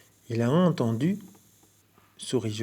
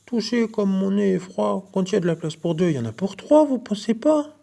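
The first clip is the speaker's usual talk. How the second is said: small pleading voice; 8 semitones higher, 3 dB louder than usual. A narrator's reading. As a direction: wheedle sweetly to get in. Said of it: "supplie d'une petite voix".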